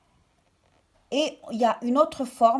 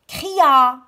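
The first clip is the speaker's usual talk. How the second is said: shouted; 3 semitones higher, 9 dB louder than usual. Shown as a demonstration. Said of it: "il crie".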